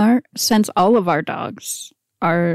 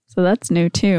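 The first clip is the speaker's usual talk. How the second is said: ordinary talk, nothing marked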